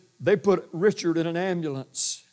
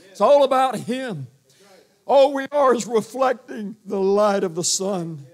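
higher, louder, slow proclamation; preaching at full force; 4 semitones higher, 4 dB louder than usual. Real, not acted. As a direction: lift it up like a pulpit climax. Preacher building to climax.

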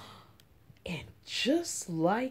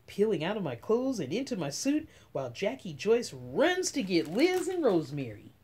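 plain reading voice

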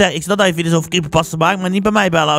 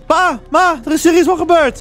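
urgent high-pitched call; acted alarm; 9 semitones higher than usual. Son tells parents something has happened, alarmed. Calls parents after disappearance.

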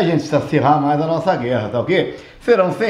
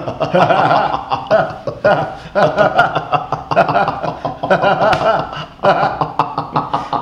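long hearty laugh. Acted. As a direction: belly laugh. Long laugh at own joke.

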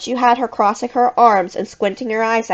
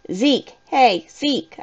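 calling out; 4 semitones higher, much slower than usual. A narrator's reading. Narrator calling to a masked friend on stage. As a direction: call for attention with a rising end. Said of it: "I called".

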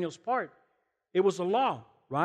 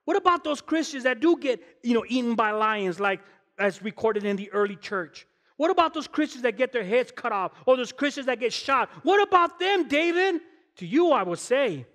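loud, high, fervent preaching at full force; 8 semitones higher, 5 dB louder than usual. Acted. Pastor rallying the congregation about martyrs, in full-force preaching. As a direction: preach it out, rising up to the rafters.

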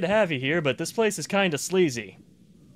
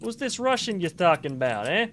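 put-on New York accent; 6 semitones higher than usual. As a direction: ask around like a curious New York tough guy. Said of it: "this New York accent's gonna piss someone off".

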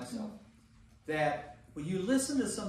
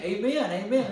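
higher, louder hammed-up amens; mock congregation amen; 5 semitones higher, 7 dB louder than usual. Teacher imitating the class agreeing, mock amen. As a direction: play the agreeing crowd, tongue in cheek. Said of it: "Everybody's, everybody's saying".

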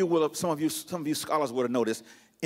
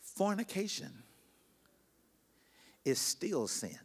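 grave, hushed pulpit pauses; hushed gravity; 12 dB quieter than usual. Real, not acted. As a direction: drop almost to a whisper and let each word sink in. Lets weighty point sink in.

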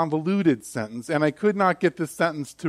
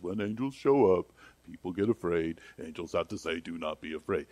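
soft, lower imitation of a preachy pastor; mock pastor voice; 9 semitones lower, 8 dB quieter than usual. Preacher mocks how every pastor says it, softer and lower. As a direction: lightly mimic the standard pulpit line. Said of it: "every pastor has to say this".

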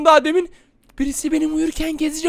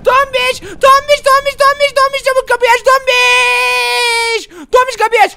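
frantic calling; 6 semitones higher than usual. Acted. Scared, begging Tombiş to open the door, frantic shouting. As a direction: pound the door with your voice.

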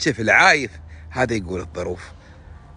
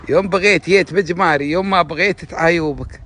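يوم بغيت جيت بجماري said higher and louder, each phrase falling; emphatic recital; 6 semitones higher, 4 dB louder than usual. Shown as a demonstration. Man repeating a saying to his listeners, emphatic and louder. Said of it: "repeats the saying emphatically".

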